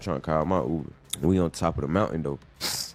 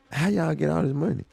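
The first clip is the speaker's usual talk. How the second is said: amazed asking; 10 semitones higher than usual. Retold or imitated. Asking rich hosts, amazed.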